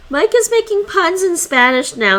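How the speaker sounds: high, loud mock exasperation; exasperated outburst. Real, not acted. Streamer reacts to a viewer's pun, exasperated. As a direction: groan at a pun, loudly.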